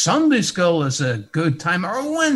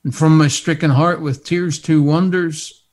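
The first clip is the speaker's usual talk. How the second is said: hymn recitation; 6 dB louder than usual. Acted.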